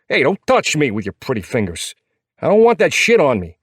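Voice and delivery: high, indignant New York protest; thick New York accent. A narrator's reading. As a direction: warn him off like a scrappy New Yorker. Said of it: "His New York accent is thick and full of attitude".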